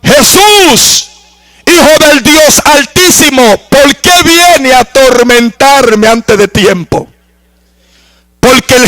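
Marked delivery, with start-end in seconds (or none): 0.0-7.1 lightly voiced demoniac calling out to Jesus; possessed man crying out. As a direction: plead as the tormented man. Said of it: "le dijo a gran voz".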